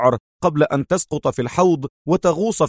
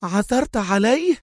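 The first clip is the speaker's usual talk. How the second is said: high, slow, startled question; eager exclamation; 10 semitones higher than usual. Acted. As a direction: seize the news. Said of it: "وهي تهتف".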